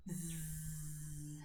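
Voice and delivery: low, soft buzzing hum out through the mouth; gentle bee buzz breath; bumblebee buzz. Shown as a demonstration. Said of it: "let's just do a few bumblebee breaths".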